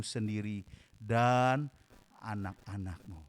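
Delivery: low, slow, solemn voice of Jesus; Jesus turning to the daughters of Jerusalem, low and solemn; Jesus speaking. Acted. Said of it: "Yesus berpaling kepada mereka dan berkata".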